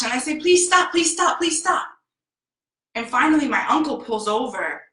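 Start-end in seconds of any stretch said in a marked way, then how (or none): 0.4-1.9 high, repeated frightened begging; terrified pleading. Retold or imitated. Terrified child in the backseat fearing a crash, frantic pleading. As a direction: re-enact a panicked child's plea. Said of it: "I'm terrified in the backseat".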